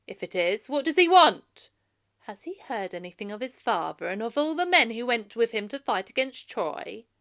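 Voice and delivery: put-on old elder speaking up loud before the assembly; old man addressing assembly. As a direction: call out to the men of Ithaca. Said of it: "Aegyptus said".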